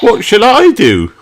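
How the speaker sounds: high, loud fairy nanny; Nanny Plum wondering. Acted. Nanny Plum asks for a job. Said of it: "wonders Nanny Plum".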